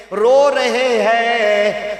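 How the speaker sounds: very slow, loud and drawn out; slow emphasis. A narrator's reading. Preacher tells of Ibrahim weeping, very slow and loud. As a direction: stretch it out, moved.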